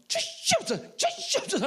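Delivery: high, loud repeated begging; mock loud pleading. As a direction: beg at full force, mockingly. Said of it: "막 하는 거예요".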